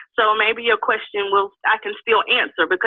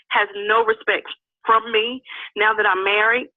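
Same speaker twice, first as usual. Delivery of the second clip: ordinary talk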